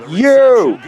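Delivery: high, loud "Yo"; loud high shout opening a mock threat; angry yell. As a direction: bark it to start a threat. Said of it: "So I'm like".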